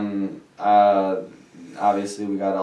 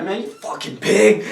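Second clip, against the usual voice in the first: mock insult yell; 10 semitones higher, 4 dB louder than usual. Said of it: "I'm like".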